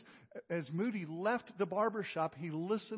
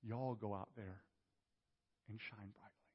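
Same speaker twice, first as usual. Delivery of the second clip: soft collective y'all; 7 semitones lower, 10 dB quieter than usual. Pretend. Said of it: "Instead, what he's saying is".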